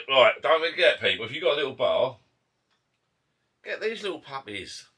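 loud, high, eager sales pitch; excited pitch. Real, not acted. Vlogger urging viewers to buy the lights, loud and high. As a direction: sell it to the viewers.

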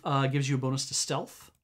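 normal talking voice

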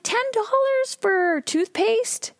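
very high, slow, drawn-out incredulous question; passive-aggressive. Acted. Shopper to store owner about the price, passive-aggressive. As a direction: stretch it out high in mock disbelief. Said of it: "I'm getting a little passive aggressive".

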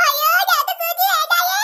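put-on high, slow, drawn-out child's wail; alarmed wail. Child fleeing in a chase, alarmed wail. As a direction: cry out in hammed-up alarm.

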